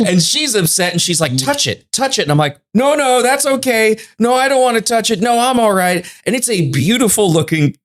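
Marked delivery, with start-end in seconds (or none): 1.4-2.2 higher, pushy urging voice; insistent older woman. Retold or imitated. Woman urging them to touch. 2.7-6.0 higher, nervous cool-dude refusal; Wayne's World mode. Retold or imitated. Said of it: "I go full Wayne's World mode".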